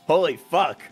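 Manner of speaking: normal talking voice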